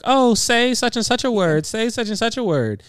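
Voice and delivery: high, loud mock begging; hammed-up fascinated onlookers; imitating eager crowd. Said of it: "So everybody was like".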